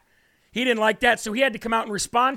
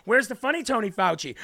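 usual speaking voice

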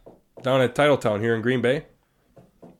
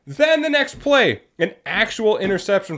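high, drawn-out exclamation; incredulous excitement; 7 semitones higher than usual. Real, not acted. Host recapping a blunder-filled football game, incredulous excitement. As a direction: stretch it out high in disbelief.

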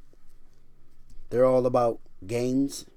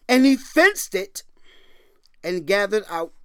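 proclaiming; 7 semitones higher, 7 dB louder than usual. Acted. Preacher reading Isaiah aloud, ringing proclamation. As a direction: ring out the verse.

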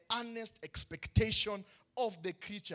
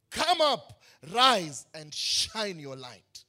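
proclaimed call; 8 dB louder, much slower than usual. Acted. Preacher voicing God's call.

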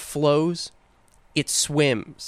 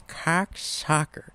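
slow, drawn-out Cocksucker, a hint of a cartoon dad; lightly voiced cartoon dad saying Cocksucker; Peter Griffin impression; 4 semitones higher, 5 dB quieter, much slower than usual. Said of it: "Peter Griffin".

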